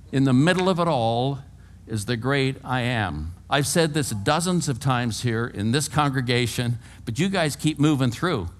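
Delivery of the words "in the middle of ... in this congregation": ordinary talk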